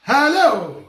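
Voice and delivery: loud, high hello down the line; calling out. Real, not acted. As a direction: call out to check the caller is there. Host calling out to caller on air.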